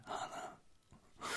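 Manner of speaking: barely audible defeated whisper; very soft mock-dismayed whisper. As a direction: breathe it out under your breath.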